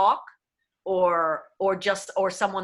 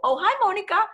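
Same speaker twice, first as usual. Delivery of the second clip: Oh, hi, Monica. viel höher und lauter, spanischer Akzent; accented greeting; 12 semitones higher, 5 dB louder, faster than usual. Shown as a demonstration. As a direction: chirp Oh, hi, Monica. high and bright. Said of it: "So if I'm saying, for example".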